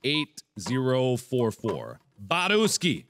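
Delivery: usual speaking voice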